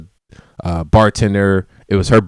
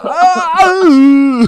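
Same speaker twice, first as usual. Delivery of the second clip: pained cry; 4 dB louder than usual. Retold or imitated. Champagne burning his eyes.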